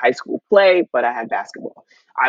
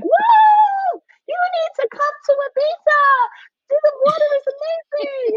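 hammed-up TV host voice, much higher, gushing and excited; Brooke Burke impression. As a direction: squeal it like a giddy travel-show host on a jet ski. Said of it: "and she's like".

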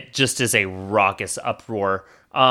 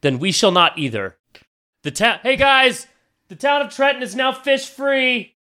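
proud decree; 12 semitones higher than usual. Acted. Baron declares the town fish free.

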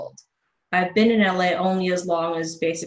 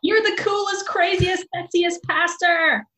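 high, gushing fan voice; hammed-up swooning over the pastor; mock gushing; 11 semitones higher than usual.

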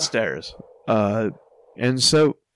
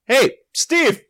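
high, loud call down the block; imitated distant call; 12 semitones higher, 5 dB louder than usual. Walkers hear a voice half a block away, calling out. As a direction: holler after someone walking away.

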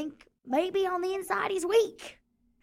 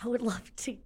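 much softer, lower thanks; soft lower thanks with a laugh; quiet gratitude; 4 semitones lower, 8 dB quieter than usual. Acted. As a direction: murmur it sincerely with a grin. Grateful, sheepish reply to Chuck.

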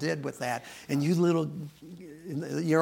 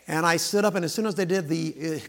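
ordinary talk

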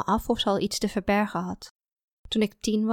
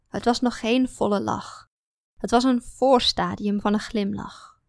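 plain reading voice

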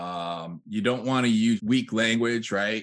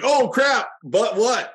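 much higher and louder, mock alarm; alarmed reaction; 13 semitones higher, 7 dB louder, slower than usual. Listener hearing but after a compliment, mock alarmed outburst. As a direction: jump up in alarm at the word but. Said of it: "and now it's like".

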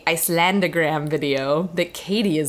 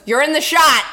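raised, higher retold call; urgent warning; 7 semitones higher, 7 dB louder than usual. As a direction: call it out to someone in the way. Quoted warning on a shoot.

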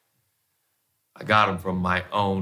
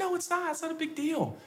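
much higher and softer dismissive wife; imitating wife; 7 dB quieter than usual. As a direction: wave it away lightly in her voice. Wife's quote voiced in story.

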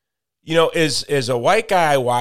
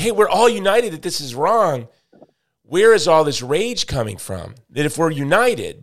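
higher, louder appeal to reason; puzzled protest; 5 semitones higher, 5 dB louder than usual. Acted. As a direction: voice an insistent, baffled objection.

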